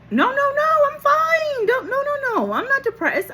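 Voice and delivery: very high, chirpy denial; insistent protest. Retold or imitated. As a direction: insist you're fine in a high, eager voice. Patient protests cheerfully against doctors' verdict.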